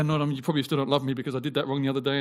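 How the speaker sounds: hurried, fretful excuse; fast anxious whine. Retold or imitated. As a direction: gabble it out like a worried believer. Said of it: "And we go".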